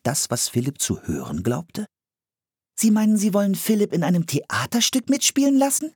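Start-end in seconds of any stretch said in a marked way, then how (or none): 2.7-5.9 put-on very high child's voice; high-pitched astonished question; incredulous girl. A narrator's reading. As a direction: check it in a girl's disbelieving voice. Fragte Anne.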